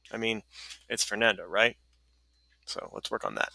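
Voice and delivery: ordinary talk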